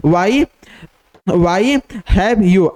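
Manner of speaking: drawn out, word by word; slow dictation. Shown as a demonstration. Teacher dictates the phrase slowly to the class. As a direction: read it out slowly for students to write. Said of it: "teacher dictating a shorthand phrase".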